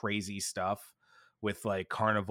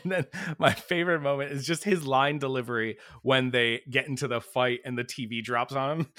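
normal talking voice

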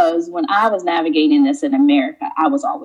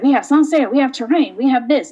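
proud shopkeeper; 7 semitones higher, faster than usual. Retold or imitated. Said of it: "He's like".

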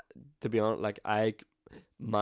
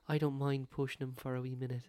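higher light put-on Kira voice; Kira impression; 5 semitones higher than usual. Imitating Kira offering to push, lightly voiced. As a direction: play Kira sweetly offering. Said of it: "Did you like that impression?".

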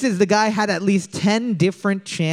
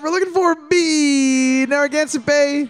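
game-show announce; 9 semitones higher than usual. Real, not acted. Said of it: "Boards up".

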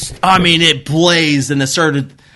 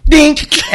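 hose snap sound; 14 semitones higher than usual. Retold or imitated. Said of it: "hose snapping sound".

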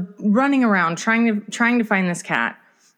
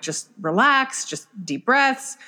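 slow, higher-pitched reassurance; retold soothing; 4 semitones higher, slower than usual. Parent to crying daughter while holding cat, slowly soothing. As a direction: draw the words out to settle someone. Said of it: "trying to keep her calm".

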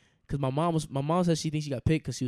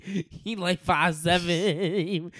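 laughing; 4 semitones higher, 7 dB louder, much slower than usual. Real, not acted. Laughing at short Beast.

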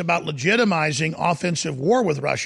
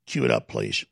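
usual speaking voice

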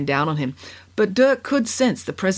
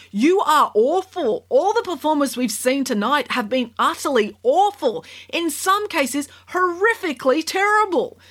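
sarcastic scolding tv judge; 10 semitones higher than usual. A narrator's reading. Judge insults performers.

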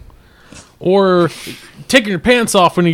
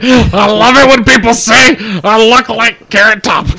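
higher, louder mock impression of a friend; mocking Joseph; 6 semitones higher, 6 dB louder than usual. Teasing Joseph by pretending to quote him, loud mocking voice. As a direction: put on a loud, high voice to mimic a friend. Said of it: "Joseph's like".

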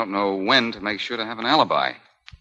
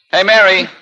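loud, high call down the street; 10 semitones higher, 7 dB louder than usual. Acted. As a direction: call her back from afar. Calling to Mary down street.

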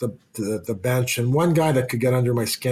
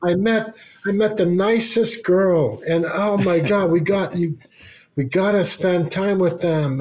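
high, gushing wife, slow on oh my God; excited wife; 8 semitones higher than usual. Retold or imitated. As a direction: mimic the thrilled wife gushing.

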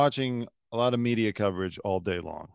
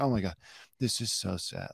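mock sad; 4 semitones lower, 9 dB quieter than usual. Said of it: "the C N N or M S N B C crew sitting up there".

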